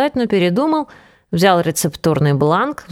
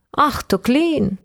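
mock delight; 6 semitones higher, much slower than usual. A narrator's reading. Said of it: "повторила она".

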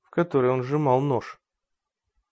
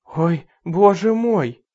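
slow, higher cry of alarm; frightened cry; 6 semitones higher, 6 dB louder, much slower than usual. Acted. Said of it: "вскрикнула женщина".

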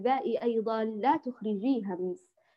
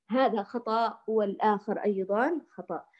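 ordinary talk, nothing marked

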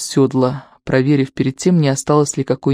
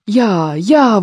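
higher-pitched rider's shout, mildly done; crying out; 5 semitones higher than usual. A narrator's reading. Ivan spurring his horse downhill, crying out. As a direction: urge the horse on with a raised voice. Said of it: "выкрикивает Иван".

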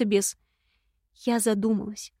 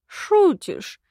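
high-pitched, stunned question; taken aback; 9 semitones higher than usual. A narrator's reading. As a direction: jump up high in disbelief. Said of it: "опешил ушастый". (ошарашенно, высоким голосом)

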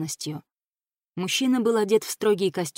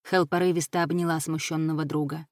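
ordinary delivery

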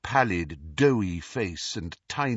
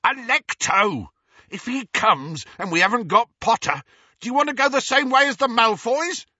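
high, loud desperate yelling; 13 semitones higher, 6 dB louder than usual. A narrator's reading. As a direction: bellow at the locked door. Amycus yelling at locked door.

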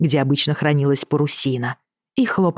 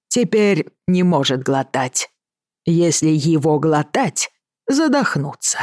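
put-on Chinese accent; slower than usual. Mr Willoughby's broken Russian.

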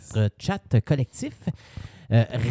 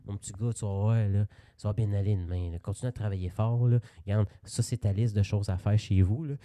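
calm fatherly reassurance; 4 semitones lower than usual. Retold or imitated. Coach consoling a struggling player in his office, calm and fatherly. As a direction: soothe him like a gentle papa. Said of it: "il te parlait calmement".

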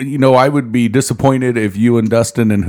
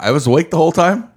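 ordinary delivery

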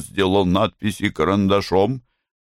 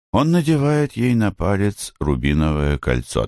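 ordinary delivery

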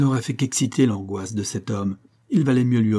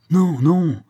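higher, louder angry outburst; furious cry; 7 semitones higher, 6 dB louder than usual. A narrator's reading. John refusing the coroner, furious loud cry. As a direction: snap it out in fury. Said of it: "s'écria John avec fureur".

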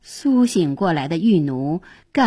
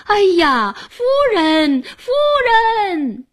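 false cry of alarm; 12 semitones higher, 3 dB louder than usual. A narrator's reading. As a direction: feign alarm, wailing out for the lady. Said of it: "反呼".

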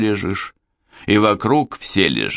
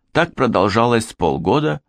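plain reading voice

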